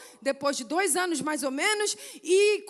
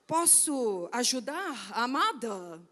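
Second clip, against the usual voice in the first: low, slow put-on man's voice; Júlio; 5 semitones lower, much slower than usual. Missionary offering help on the phone, deep slow voice. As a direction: drop low and draw it out suavely. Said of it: "Eu não sei imitar a voz dele".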